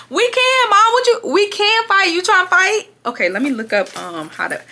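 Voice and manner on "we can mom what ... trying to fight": high-pitched, blustering challenge; mock bravado. First-time fighter answering the friend, mock bravado. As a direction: bluff your way into a fight, pitched up.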